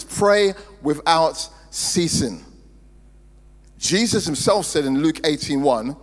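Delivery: deliberate pulpit cadence, drawing out the final words; emphatic preaching. Real, not acted. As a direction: drive each phrase home like a preacher. Builds emphatic sermon refrain.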